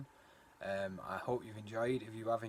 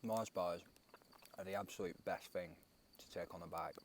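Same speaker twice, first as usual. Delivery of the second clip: talking with mouth full; 4 semitones lower, 14 dB quieter than usual. Real, not acted. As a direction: speak through a mouthful of food. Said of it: "Sorry for talking with my mouth full".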